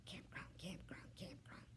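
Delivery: soft breathless whisper, no voice at all. A narrator's reading. Dog whispering a secret into Kayla's ear, whisper. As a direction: breathe the word into her ear. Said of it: "I whisper in Kayla's ear".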